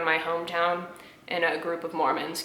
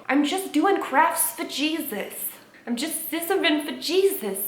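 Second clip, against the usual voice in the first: mock-innocent crafter; 9 semitones higher than usual. Crafter claiming it's all for Jesus, mock innocence. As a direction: put on an exaggeratedly sweet high voice.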